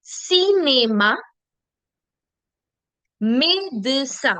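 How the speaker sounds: "Cinema, me, de, sa" drawn out slowly with "ay" on the accented E; syllable-by-syllable model. Shown as a demonstration. Teacher pointing out accents, "Cinema, me, de, sa" slow and syllabic. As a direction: stretch each syllable of "Cinema, me, de, sa". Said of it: "There's an accent right here".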